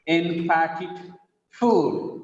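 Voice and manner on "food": ordinary talk, nothing marked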